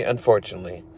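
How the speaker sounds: resigned, dropping "unfortunately"; fading sigh. Shown as a demonstration. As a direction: let "unfortunately" sink away.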